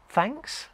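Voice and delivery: THANKS softly rising high, like an unsure question. Acted. Presenter quoting own reply to an odd compliment, THANKS rising questioningly. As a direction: say THANKS as a puzzled question, pitch climbing. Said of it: "Question mark?".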